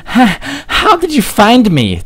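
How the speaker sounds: very high, stumbling little fox voice; stuttering young Tails. Acted. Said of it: "Tails stuttered".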